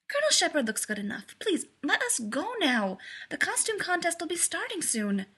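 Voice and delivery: bubbly impatience, voice lifting high; excited. A narrator's reading. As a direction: urge them along excitedly. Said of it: "bouncing excitedly on her toes".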